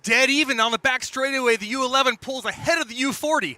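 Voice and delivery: high, loud, urgent race call; excited shout. Real, not acted. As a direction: yell it over the engines. Tight finish, co-host shouting.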